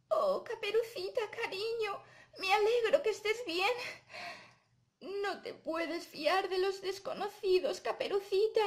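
high, fond granny puppet voice; comfort, then gently warn in a high voice; tender grandma. Acted. Grandma relieved Caperucita is safe, tender advice.

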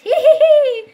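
high, loud gloating hee hee; villain giggling; evil giggle. Pretend. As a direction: giggle like a wicked doll. Doll gloats with knife.

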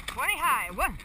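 shouted stroke call; loud rowing call. Real, not acted. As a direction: bark it over the boat. Coxswain calling the stroke.

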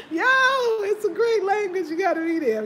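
talking high and loud through laughter; can barely get the words out for laughing; real giggling speech. Real, not acted. Laughing while telling.

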